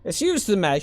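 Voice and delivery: loud, high call from the gate walls; shouting down. Acted. Varl calling down to newcomers from above the gates, shouting. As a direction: call down over the wall. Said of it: "shouts a voice above the gates".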